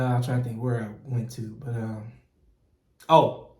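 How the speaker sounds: normal talking voice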